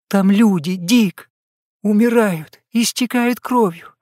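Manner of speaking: high, sobbing cry of protest; angry crying. A narrator's reading. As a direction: cry it out, pleading and upset. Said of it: "рыдая, закричала она".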